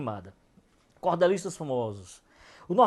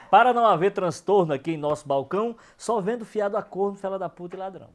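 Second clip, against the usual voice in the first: declaims the rhyming quatrain, starting louder; verse recital; 3 semitones higher, 4 dB louder than usual. Acted. Poet recites a comic shop quatrain, declaiming.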